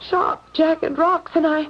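high, trembling, near tears; badly shaken after a near fall. Shaken after nearly falling.

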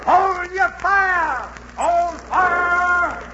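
slow, stretched loud call; long drawn shout. Acted. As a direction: drag the order out across the water. Captain ordering crew to cease.

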